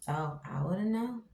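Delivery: ordinary talk, nothing marked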